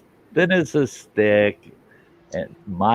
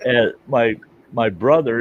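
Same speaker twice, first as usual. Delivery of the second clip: ordinary talk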